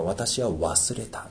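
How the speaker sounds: very slow, soft voice of God; quiet quote. Acted. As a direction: say it gently and slowly. 神の言葉を引用. (ゆっくり静かに)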